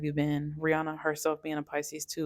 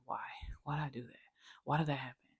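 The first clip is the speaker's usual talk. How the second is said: whisper; 2 semitones lower, 8 dB quieter, faster than usual. Retold or imitated. Inner voice whispered.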